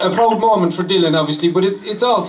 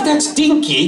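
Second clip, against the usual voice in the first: high, laughing "dinky"; laughing correction; 10 semitones higher, slower than usual. Retold or imitated. Speaker correcting the Belgian rider, laughing high. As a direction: laugh through the word "dinky". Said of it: "And I says".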